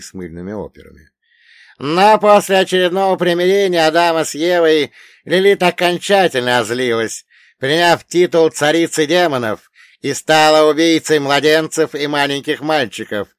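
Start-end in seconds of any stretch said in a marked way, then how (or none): 1.8-13.3 high, loud elder quizzing a pupil; raised, emphatic voice of a learned old man; old storyteller pitched high and forceful; wise old dead man. Acted. Old man concludes the legend.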